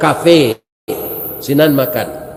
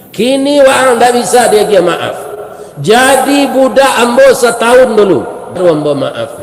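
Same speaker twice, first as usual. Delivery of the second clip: stern king; 6 semitones higher than usual. Acted. King setting the youth a year of servitude, raised commanding voice. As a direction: lay down the terms like a ruler. The king states his condition.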